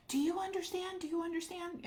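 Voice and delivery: high, loud insistent repeat; mock demanding. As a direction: hammer the question at him like she did. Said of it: "coming at me really hard".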